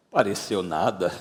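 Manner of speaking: low, soft scoff; dismissive disbelief. Acted. Tomé answering news that Jesus appeared, dismissively. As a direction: brush the news off as nonsense.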